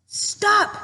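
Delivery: high, sharp burst of protest; loud mouse's shout. Acted. Tiny mouse telling a huge cat off, loud shout. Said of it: "She was saying it really loud too".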